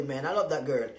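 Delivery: ordinary talk, nothing marked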